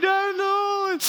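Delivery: put-on high, drawn-out toddler wail; mock crying. Two-year-old caught by dad, imitated high crying. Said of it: "he just instantly starts crying".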